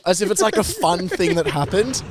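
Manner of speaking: normal talking voice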